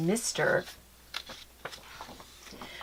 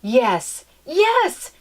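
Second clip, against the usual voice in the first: high, loud bursting yes; violent enthusiasm; 6 semitones higher, 6 dB louder than usual. Acted. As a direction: burst out with delight. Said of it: "cried Mamma with such a violent enthusiasm".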